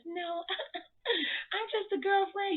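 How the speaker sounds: high, giggly, bashful voice; giddy girlfriend. Retold or imitated. Miranda gushing to the fans, giggly and high. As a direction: play her tickled to be asked. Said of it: "and she says".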